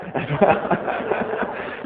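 breathy, voiceless chuckle; laugh. Real, not acted. Preacher enjoying an inside joke, chuckling.

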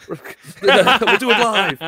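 slow words through laughter; laughing. Real, not acted.

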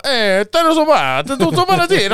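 high, loud mock-Italian back-and-forth; hammed-up Italian relatives, stretched and sing-song; aunts talking Italian. Imitating the aunts going back and forth, loud mock Italian. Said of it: "they're going".

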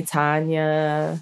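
"Tanya" said slowly, as a wrong name; mispronounced name. Retold or imitated. Guest quoting the wrong name people call her, mispronouncing it "Tanya". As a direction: imitate people's mistake, drawing out "Tanya". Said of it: "people always mess up my name".

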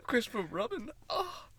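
high-pitched storybook bear voice; Winnie the Pooh. Acted. Friend taking a turn at the bear, high impression. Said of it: "I'm gonna do my poo impression".

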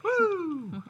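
high, loud whoop; excited whoop. Acted. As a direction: whoop like a hype host. Podcast host opening segment.

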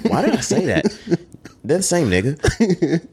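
high-pitched, laughing self-mockery; laughing at own slip. Real, not acted. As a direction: say it through helpless laughter, voice shooting up.

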